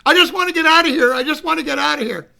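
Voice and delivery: fast, high outburst, loud at first; desperate plea. Retold or imitated. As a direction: blurt it out like a panicky man. Dave desperate to get out.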